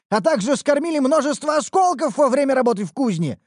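high, loud offended tone; indignant haggling. Acted. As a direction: push back at a lowball offer. Smith indignant about price.

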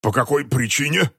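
coughing out the words; coughed out. Acted. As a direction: cough it out, choking on vodka. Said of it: "прокашлял Эрик Шепелявый".

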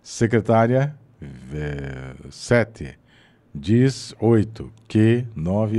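slow, each word followed by a counted number; counting between words. Shown as a demonstration. Teacher demonstrating the numbered pause exercise, slow counting. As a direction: tick off a number after every word. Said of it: "você coloca lá um número no meio".

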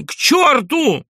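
very high, drawn-out yell; tearful shout. A narrator's reading. Doctor shouting after fleeing guests, tearful shout. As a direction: wail the curse at full voice. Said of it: "крикнул он плачущим голосом".

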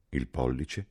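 usual speaking voice

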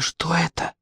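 very soft, stunned question; whisper. A narrator's reading. Петя asks his caught friend, stunned whisper. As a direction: ask it under your breath. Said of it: "прошептал Петя".

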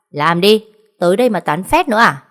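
loud, sharp reprimand after slamming the ruler; angry scolding. Acted. Supervisor scolding workers for chatting, loud and angry. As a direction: bark at the chatterers to get back to work.

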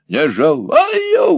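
very high, slow shriek; very high furious squeal. Acted. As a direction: shriek the refusal. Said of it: "взвизгнула женщина".

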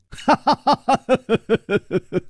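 high, drawn-out ha-ha laugh; hearty stage laugh. Acted. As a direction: laugh out high in triumphant peals. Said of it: "楚留香笑着说".

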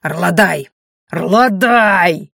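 sharp loud barked name; barked call. A narrator's reading. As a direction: bark the name out. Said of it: "рявкнул".

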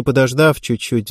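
usual speaking voice

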